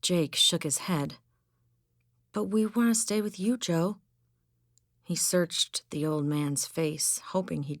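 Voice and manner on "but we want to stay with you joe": put-on young boy, higher and fast; pleading boy. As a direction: beg like a desperate kid.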